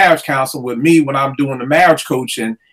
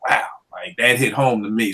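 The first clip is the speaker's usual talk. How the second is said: hushed awe; 5 semitones lower, 7 dB quieter than usual. Retold or imitated. Struck by friend's words.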